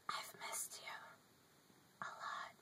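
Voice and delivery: breathy whisper to phone signal. Real, not acted. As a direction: whisper it tenderly. Whispered love to 4G.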